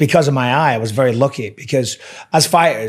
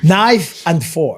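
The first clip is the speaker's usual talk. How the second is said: "Knife and fork." said very slowly, each word spelled out; slow corrective; 4 semitones higher, much slower than usual. Shown as a demonstration. A dad slowly correcting his son's "fork" while laughing. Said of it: "I was like".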